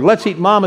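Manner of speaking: "let's eat, mama" with a break before "mama"; pause before mama. Shown as a demonstration. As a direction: stop on "eat", then "mama". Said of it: "is a very, very different thing".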